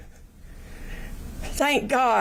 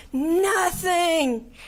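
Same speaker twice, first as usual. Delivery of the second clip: high, breaking sob on the word Nothing; crying; 6 semitones higher than usual. Real, not acted. Victim to the young killer, the word breaking into tears. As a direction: let "Nothing" crack into tears. Tells killer his life wasted.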